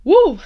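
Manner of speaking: sharp loud whoop shaking off tears; loud high woo to hold back tears. Real, not acted. Host fighting tears on air, loud high woo. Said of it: "I'm not about to cry".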